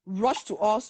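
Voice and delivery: ordinary delivery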